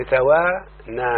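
توانا split slowly as "tawaa... naa"; drawn-out syllables. Shown as a demonstration. Teacher showing the student the pattern, توانا slowly split. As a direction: stretch each long vowel of توانا, pausing before "naa".

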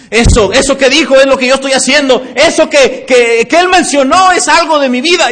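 high, loud burst of recognition; sudden realisation. Retold or imitated. Churchgoer struck by the sermon, exclaiming loudly. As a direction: cry out as the truth hits.

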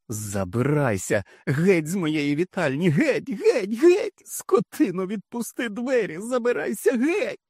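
building to very high screams; hysterical screaming. A narrator's reading. Wife screaming at husband, building to shrieks. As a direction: scream him out, losing control.

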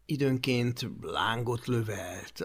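ordinary delivery

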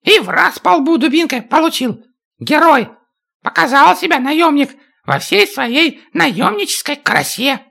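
high, sneering little dwarf; mock the mercenary, high and scornful. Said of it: "Чак издевательски хохотнул".

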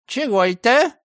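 put-on high puzzled old woman's voice. A narrator's reading. Old gatekeeper puzzled at the gate, high voiced. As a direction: squint and ask like a half-deaf granny.

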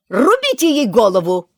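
loud screamed order; full-voice shout. Acted. Queen orders Alice beheaded, shouting. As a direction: scream it at the top of her voice. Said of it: "крикнула королева во весь голос".

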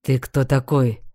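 slow, low, cold lord; icy voice. A narrator's reading. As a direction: freeze him with an icy question. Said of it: "ледяным голосом спросила лорд".

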